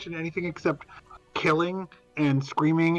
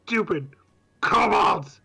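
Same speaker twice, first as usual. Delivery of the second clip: frustrated outburst; much slower than usual. Real, not acted. Player cursing enemies after bees.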